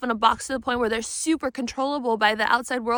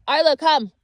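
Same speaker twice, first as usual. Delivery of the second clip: loud, higher call to a dog; 3 semitones higher, 7 dB louder, slower than usual. Real, not acted. Owner calling her dog Arlo, loud and high. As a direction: call the dog across the room.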